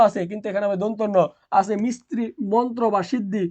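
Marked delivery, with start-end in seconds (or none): none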